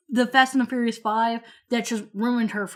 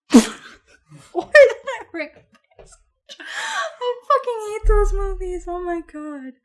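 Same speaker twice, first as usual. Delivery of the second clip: high, slow laughing through words; cracking up, barely able to talk; 7 semitones higher, slower than usual. Real, not acted. Laughing fit after Ice Age.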